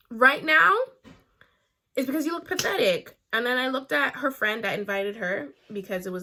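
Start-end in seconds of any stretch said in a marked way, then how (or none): 0.1-3.2 loud, scornful "you look pathetic"; loud contempt. Retold or imitated. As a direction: spit out the insult, loud. Threatening the girl.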